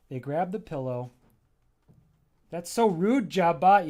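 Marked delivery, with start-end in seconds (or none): 2.5-3.9 indignant scold. Acted. Pillows scold Jobbot.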